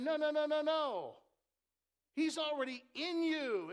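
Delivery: high, loud no, no, no; Paul protesting. Retold or imitated. Preacher voicing Paul rebuking the heresy, loud and high. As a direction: cut them off hard as Paul. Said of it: "And Paul's saying".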